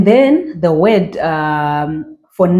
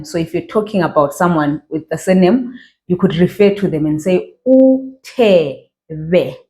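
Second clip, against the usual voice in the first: ordinary delivery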